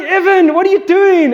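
high, loud incredulous call; half laughing at the kid; exasperated dad. Retold or imitated. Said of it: "I'm like".

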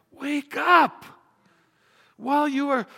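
loud, high wake-up cry; sudden full-voiced preacher yell; urgent shout. Real, not acted. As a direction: shout it to rouse sleepers. Yelled version after quiet ones.

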